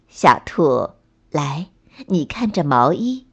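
mild, low motherly coaxing; calm mother. A narrator's reading. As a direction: invite a child over in a low, gentle tone. Mother calmly teaches her child.

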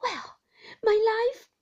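put-on a fond nurse answering her boy; Peggotty's voice.

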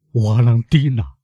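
stricken, lingering utterance of a name; pained cry. A narrator's reading. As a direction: draw the name out in grief. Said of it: "一声痛苦的呜咽".

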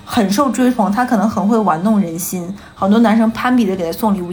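ordinary talk

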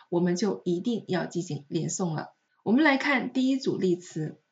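usual speaking voice

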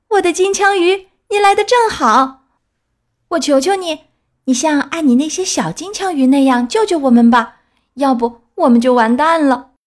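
high, loud desperate begging; desperate plea. A narrator's reading. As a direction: beg for rescue, shouting. Drowning boy begs tuna.

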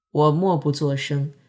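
ordinary delivery, nothing marked

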